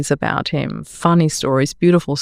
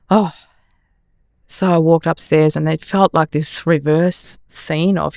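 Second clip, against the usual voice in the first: usual speaking voice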